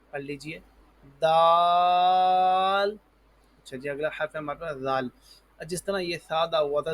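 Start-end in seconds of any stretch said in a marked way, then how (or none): 1.2-3.0 دال with tongue tip at upper tooth roots, vowel held long; tongue-tip daal. Shown as a demonstration. Teacher models the letter for pupils, long, loud دال. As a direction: sustain دال loudly on one note. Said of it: "اوپر والے دانتوں کی جڑ".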